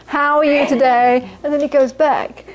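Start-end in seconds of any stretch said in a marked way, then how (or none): 0.1-1.3 slow, mechanical customer greeting; bag packer. Acted. Packer breaking off his chat for a customer, automatic drawn-out drone. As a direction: drone it out absent-mindedly, stretched. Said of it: "he's actually focused on the conversation he's having".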